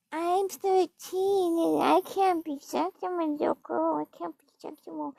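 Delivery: slow, high, breathy-soft mock little girl. Mocking the woman from the video, slow high little-girl voice. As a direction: mimic her coy little-girl act, dragging it out. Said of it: "Remember the video where she's saying".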